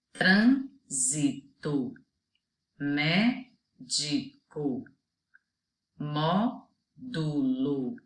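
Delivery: each word drawn out slowly, syllable by syllable; slow example words. Shown as a demonstration. Teacher reading examples to children, slow and clear. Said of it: "Outros exemplos".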